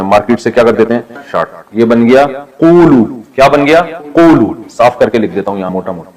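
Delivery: ordinary talk, nothing marked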